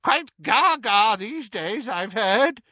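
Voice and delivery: high, loud, drawn-out jibe; gleefully dismissive aunt; cheerful. A narrator's reading. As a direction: sing out the put-down cheerfully. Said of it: "said Auntie Muriel cheerfully".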